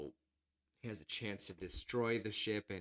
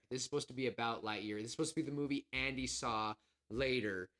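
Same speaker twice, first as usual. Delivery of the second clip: usual speaking voice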